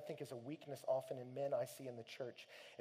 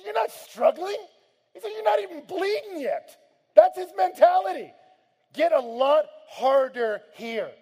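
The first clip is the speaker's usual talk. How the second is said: much higher, shouted, intense; shouted exhortation; 20 dB louder than usual. Acted. Preacher voicing Hebrews to the congregation, shouted exhortation. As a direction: hammer it with full force.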